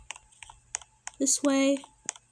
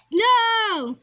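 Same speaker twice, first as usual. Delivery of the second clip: higher, drawn-out "no"; dismayed cry; 6 semitones higher, 4 dB louder than usual. Real, not acted. Player failing a jump in the game, dismayed cry.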